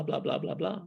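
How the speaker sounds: ordinary talk, nothing marked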